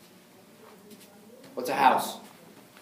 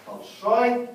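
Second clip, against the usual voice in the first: большой lifted high on the end syllable; stressed большой; 10 semitones higher, 5 dB louder than usual. Shown as a demonstration. Teacher highlights the big ending, lifted большой. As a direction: hit the ой of большой.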